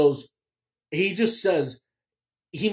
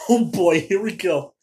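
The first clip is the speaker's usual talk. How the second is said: excited; 2 semitones higher, 6 dB louder than usual. Retold or imitated. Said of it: "sat up and went".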